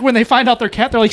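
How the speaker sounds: ordinary talk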